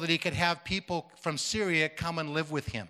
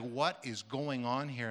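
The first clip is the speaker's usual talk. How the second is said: hushed bewilderment; 4 semitones lower, 7 dB quieter, slower than usual. Retold or imitated. Woman bewildered by the vision.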